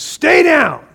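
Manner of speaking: Satan standing over the fallen, yelling to keep them down; mocking taunt. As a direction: snarl it down at someone knocked over. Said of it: "Satan says".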